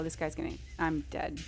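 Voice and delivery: usual speaking voice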